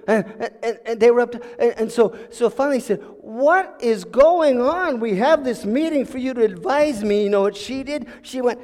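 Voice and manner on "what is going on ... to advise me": drawn-out, higher-pitched exasperation; exasperated demand. Acted. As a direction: demand an answer from silent advisors, stretching the question. King finally demands answers from advisors.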